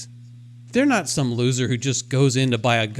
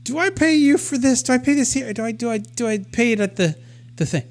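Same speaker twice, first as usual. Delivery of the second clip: high, timid asking, much higher pitch; sheepish little voice; 7 semitones higher than usual. Retold or imitated. Asking the Wawa cashier, sheepish and high. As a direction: shrink down and ask meekly. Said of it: "in this sheepish little voice".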